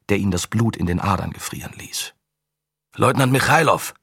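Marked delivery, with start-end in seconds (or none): 2.9-3.9 sharp loud call; barking into radio. Acted. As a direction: bark the name into the radio. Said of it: "bellte Korodin ins Funkgerät".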